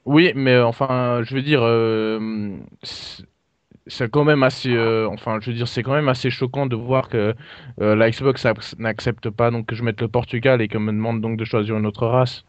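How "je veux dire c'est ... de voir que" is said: ordinary talk, nothing marked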